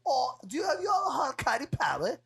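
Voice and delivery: high, teasing British voice; hammed-up British celebrity; British bloke impression. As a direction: lay on the cheeky Brit. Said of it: "He goes".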